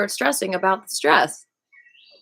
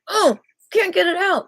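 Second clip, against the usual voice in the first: high loud whiny complaint; frustrated struggle; 11 semitones higher, 7 dB louder than usual. Acted. Someone fighting a stuck knot, high and frustrated. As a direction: strain like you're stuck and annoyed.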